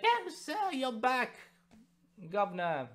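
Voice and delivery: high, lively Cockney lad voice; a light Cockney apprentice greeting his returning master. Retold or imitated. Apprentice welcomes his master home in a high Cockney voice. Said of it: "and he's like".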